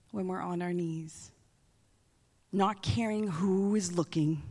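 ordinary talk

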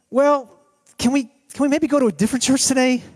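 high, halting husband's plea; hesitant pleading. Acted. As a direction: wheedle your way out of it, hesitantly.

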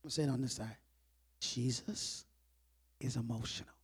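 low, soft, weighty delivery; hushed point. Real, not acted. Preacher telling both sides Jesus is emotional, hushed. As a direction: drop your voice and let each phrase land.

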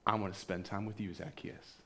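soft, fading to a whisper on the name; tender hush. Acted. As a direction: invite him gently, almost whispering. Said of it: "Jesus says".

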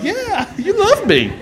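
very high, slow, adoring squeal; happy dog voice. Acted. Dog answers its owner, gleeful high-pitched dog voice. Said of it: "the dog will look at you like".

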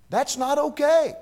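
rising emphatic declaration. Real, not acted. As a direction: build it up, ring it out. Sermon building to emotional peak.

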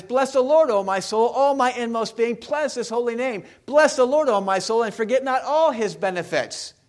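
high, loud psalm recitation; proclaimed scripture. Acted. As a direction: proclaim the psalm with rising force.

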